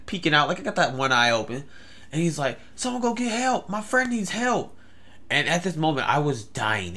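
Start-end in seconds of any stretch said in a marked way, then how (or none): 2.7-4.7 high-pitched mock call for help; imitated shouting. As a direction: shout for an ambulance, held back in volume. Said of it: "notice this guy is shouting".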